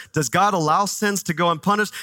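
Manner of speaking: normal talking voice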